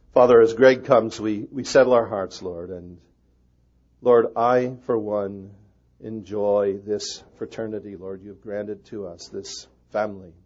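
loud opening prayer, slowing down; public prayer. Real, not acted. Pastor praying aloud for his fellow pastors, loud prayer. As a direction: lift the prayer out to the room. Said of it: "But I'm gonna pray".

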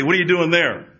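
loud, high-pitched mock demand; harsh calling out. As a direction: call it loudly as if from outside. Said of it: "He didn't say".

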